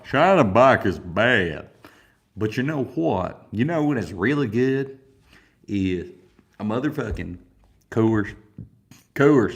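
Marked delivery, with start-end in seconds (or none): 0.0-1.7 much louder and slower, drawn out; emphatic slow verdict; loud slow declaration. Shown as a demonstration. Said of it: "repeats it with force". 9.1-9.5 Coors shouted, higher and much louder; proud loud shout of the brand. Shown as a demonstration. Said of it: "You gotta say Coors".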